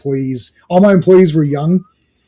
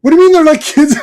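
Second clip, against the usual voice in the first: much higher, louder disbelieving cry; incredulous outburst; 13 semitones higher, 8 dB louder than usual. Retold or imitated. Retelling the movie, heroine's high disbelieving outburst. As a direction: squeal it in disbelief. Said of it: "incredulous movie quote".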